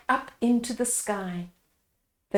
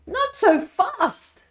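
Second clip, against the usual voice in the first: put-on much higher, scolding old man; old man warning; 14 semitones higher, slower than usual. A narrator's reading. Old man checks the villagers, scolding. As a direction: hold them back like a fussy elder. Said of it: "said the old man".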